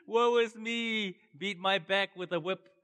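milk the complaint for pity; mock self-pity. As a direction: parody of a moaning churchgoer. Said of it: "people go".

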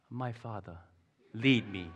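softly voiced imitation of a trusting toddler; hushed, hammed-up toddler's request; toddler's trusting plea. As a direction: say it softly, like a mock-solemn little child. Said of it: "the toddler puts ... willingly into your hand and goes".

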